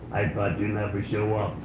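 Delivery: quiet, breathy-sounding rasp through a tracheostomy; trach voice. Retold or imitated. Comedian imitates the date with a trach, quiet and raspy. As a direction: mimic a woman speaking through a trach. Trach lady's voice in a stand-up bit.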